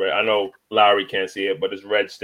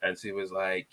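ordinary talk, nothing marked

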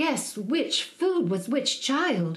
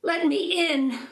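high, slow call at the door; mother's high call; 9 semitones higher than usual. Acted. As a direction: call through the witch's door. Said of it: "the mother called".

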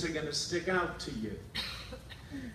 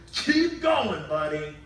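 encouraging angel; 3 semitones higher, 8 dB louder, slower than usual. Retold or imitated. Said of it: "angels again come and say".

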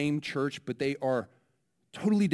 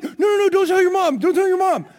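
much higher, louder and fast, a mock frantic plea; retold frantic pleading; 10 dB louder than usual. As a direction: beg him quickly not to tell anyone. Mother pleads with her son.

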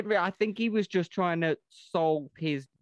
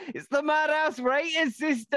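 excited announcement; 13 semitones higher, 4 dB louder than usual. Acted. Announcing the show's rating segment.

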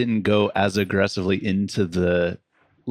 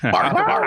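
playful bark. Pretend. Voicing the rescued dog.

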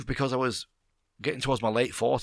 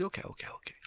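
whispered okays; 14 dB quieter, much faster than usual. Retold or imitated. Boss voiced in retold story.

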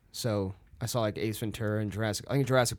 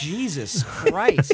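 drawn-out, laughing Jesus Christ; stunned slow exclamation; 8 semitones higher, 6 dB louder, much slower than usual. Retold or imitated. Reacting to the guy's shifting accent, slow and laughing. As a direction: let it out slowly through a laugh. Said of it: "And I'm like".

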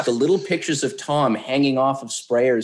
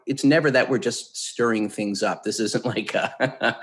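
ordinary talk

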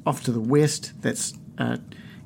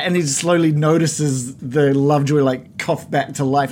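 ordinary delivery

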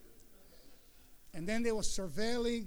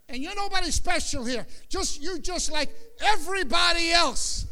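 raised, forceful rebuke, getting louder toward the end; holy indignation; 6 semitones higher, 5 dB louder than usual. Retold or imitated. Pastor telling gang leaders to get inside church, loud rebuke. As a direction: scold the shot callers with righteous anger. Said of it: "holy indignation".

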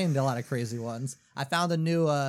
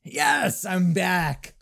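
triumphant cheer; 3 semitones higher, much slower than usual. Real, not acted. Quizmaster reacting to a player's pick, triumphant cheer. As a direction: celebrate the comeback. Cheering on being back in game.

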